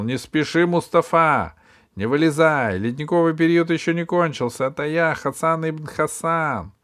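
громко, зовёт в бутылку; крикни в горлышко бутылки; calling into a bottle. Acted. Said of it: "наклонился к ней и крикнул". (громкий окрик в бутылку)

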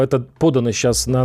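ordinary talk

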